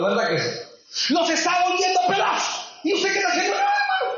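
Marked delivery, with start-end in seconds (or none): 1.1-3.5 high-pitched, indignant preaching; impassioned challenge. Acted. Preacher confronts believers over their homes, impassioned challenge. 3.6-4.1 falsetto imitation of a passive believer; mock helplessness. Preacher mimics the idle believer's answer, mock helplessness.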